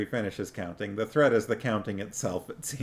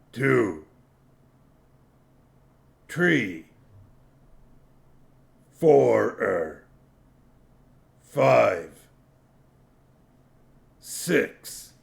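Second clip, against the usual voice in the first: troll voice; 5 dB louder, much slower than usual. A narrator's reading. Detritus counting slowly.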